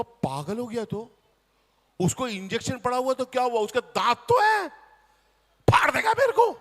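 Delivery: rising to a high loud panic; panicked. Retold or imitated. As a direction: climb into shrill alarm at the end. Panicking about the dog.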